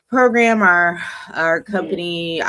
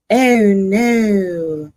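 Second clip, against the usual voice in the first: mock dismay; 6 semitones higher, 5 dB louder than usual. Dramatic high loud oh no.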